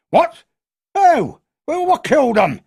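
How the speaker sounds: very high, loud disbelieving cry; shocked outburst. A narrator's reading. Red-faced drinker reacts to the deaths, shocked and loud. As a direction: react to terrible news.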